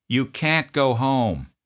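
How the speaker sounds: loud, slow, deliberate no; slow firm refusal. Retold or imitated. Drill sergeant refusing a homesick private, slow and firm. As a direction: spell it out word by word.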